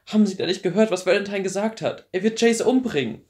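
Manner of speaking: high, loud, urgent warning; desperate pleading. Acted. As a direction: beg him, frantic with fear. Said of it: "rief Clary verzweifelt".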